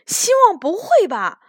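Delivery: loud answer. A narrator's reading. Huoqiu answers a friend while fleeing, loud. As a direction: call it out loudly on the run. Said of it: "火球大声地回答".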